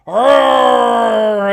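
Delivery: loud roar, a cry in it; dragon roar. Acted. Dragon roar coming closer.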